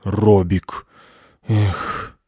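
resigned name, then a sigh; weary dejected dog. A narrator's reading. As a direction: let it out with a sigh. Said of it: "пёс вздохнул".

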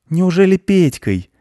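put-on high, slow child's voice; boy guessing. A narrator's reading. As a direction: draw out the guess like a little boy. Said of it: "догадался мальчик".

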